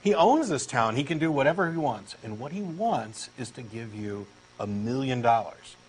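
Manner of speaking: ordinary talk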